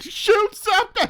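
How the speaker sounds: high, slow, loud shout; mock yell. Host acting agent shouting at the partner, hammed-up yell.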